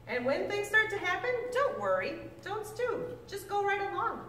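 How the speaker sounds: lively storybook read-aloud, pitch swinging up and down; reading to children. Acted. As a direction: read it like a picture book to a class of kids. Teacher reads Seuss book to class.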